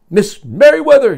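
loud, high call down the hall. Acted. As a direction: call her name as you hurry along. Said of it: "he called out as he walked".

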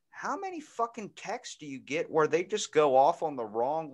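usual speaking voice

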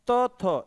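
plain dental त, then थ with added breath; weak-strong consonant pair. Shown as a demonstration. Teacher pairing त with its strong form थ, demonstrating aspiration. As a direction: contrast unaspirated त with aspirated थ, pitched high. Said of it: "इसका strong है ये".

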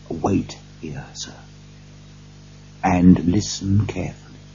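hushed, secretive instruction; whispered warning. A narrator's reading. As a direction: keep it down to a near whisper. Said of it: "whispered Poole".

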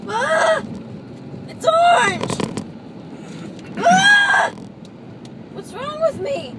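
high loud panicked screams; frightened shrieking; panicked screaming. Real, not acted. Panics at orange stain.

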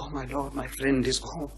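hushed private plea to God; very soft, inward praying; silent prayer. Acted. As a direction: murmur a prayer under your breath. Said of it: "He's offering up a silent prayer".

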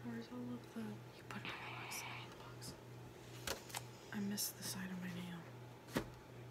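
hushed muttered aside; whispering. Real, not acted. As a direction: whisper it under your breath.

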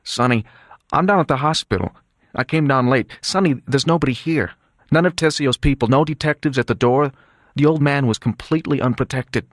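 trembling voice. Acted. Son phoning his brother from the hospital, trembling. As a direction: report trouble with a worried tremble. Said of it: "His voice was trembling".

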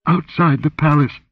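high-pitched, fearful reply; whimpering. A narrator's reading. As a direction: answer in a frightened whimper. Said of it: "she whimpered".

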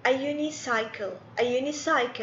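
"a unicycle" said slowly at first, with a clear "yoo"; model phrase. Shown as a demonstration. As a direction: say "a unicycle" carefully, then at normal pace. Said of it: "لأن اليو الصوت حقه يو".

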